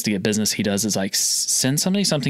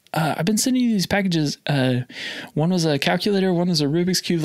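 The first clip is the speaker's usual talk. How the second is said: nervous high voice; 7 semitones higher than usual. Retold or imitated. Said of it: "my voice got, like, so high".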